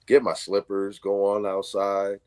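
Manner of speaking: normal talking voice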